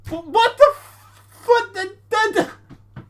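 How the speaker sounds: high, loud sputtering what the; stunned disbelief. Real, not acted. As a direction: blurt it out, too shocked to finish. Stunned by a debate question.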